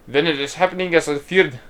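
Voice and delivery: put-on a slight slip into a Scottish accent; half-meant Scottish lilt; accidental Scottish accent. Voicing the game character, drifting into a Scottish accent. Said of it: "I don't know why I went Scottish".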